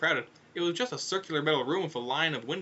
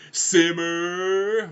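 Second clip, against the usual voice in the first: loud, high, long-held plea; pleading yell; 5 semitones higher, 7 dB louder than usual. Acted. Foyle begging once more during the crash, loud drawn-out plea. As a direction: plead at full volume, stretching the word out. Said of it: "Foyle pleaded once more".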